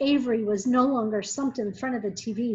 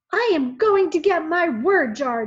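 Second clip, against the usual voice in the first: firm and loud; determined boy, raised voice; firmly; 6 semitones higher, 7 dB louder than usual. A narrator's reading. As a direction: set on getting the jar back. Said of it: "Donovan said firmly".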